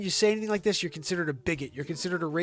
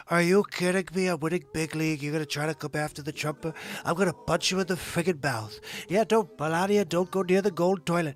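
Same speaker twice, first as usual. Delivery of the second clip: swagger it like Trump threatening his critics; over-the-top Trump parody; Donald Trump impression. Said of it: "I love doing my Trump impression".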